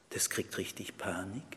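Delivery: hushed whisper. Acted. The Ich panics before God.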